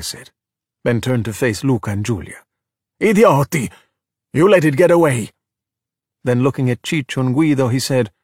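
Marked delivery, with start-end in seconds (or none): 3.0-5.3 louder, higher-pitched outburst; angry scolding. Acted. Ercole blames Luca and Giulia, angry. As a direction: berate them for their failure. Gang leader berates the children.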